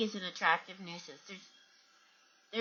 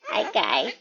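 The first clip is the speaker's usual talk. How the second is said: ordinary talk, nothing marked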